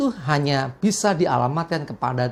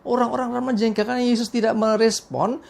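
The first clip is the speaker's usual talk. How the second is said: normal talking voice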